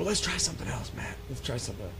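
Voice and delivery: soft, hushed suggestion; quiet conspiratorial murmur. Retold or imitated. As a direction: keep your voice down, plotting in the dark. Hushed plan in the dark.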